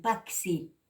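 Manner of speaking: PAXI said as pak-si; short hard k in place of the breathy x in PAXI; wrong K sound. Shown as a demonstration. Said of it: "Muchas veces dicen paxi".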